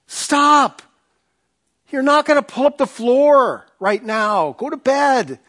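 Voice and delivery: high, insistent wife voice; firm wife. Retold or imitated. Wife orders her husband to stop and go to bed, firm. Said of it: "She goes".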